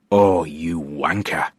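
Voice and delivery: slow, weary sigh through the words; a resigned groan of a curse. A narrator's reading. Narrator sighs at his prankster friend, slow and weary. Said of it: "I sighed".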